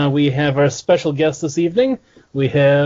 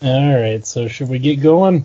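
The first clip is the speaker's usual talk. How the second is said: low-energy, lower-pitched kickoff; unexcited; 4 semitones lower than usual. Real, not acted. Host half-heartedly asks the crew to get going, unexcited. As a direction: ask it with no pep at all. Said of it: "You sound way too fucking excited".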